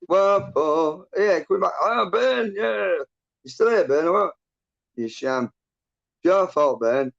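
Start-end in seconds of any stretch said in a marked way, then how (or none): 0.1-4.3 excited surprise. Real, not acted. Excited reaction on stream.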